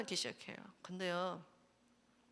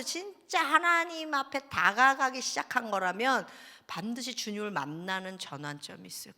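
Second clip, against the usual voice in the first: normal talking voice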